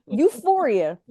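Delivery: ordinary delivery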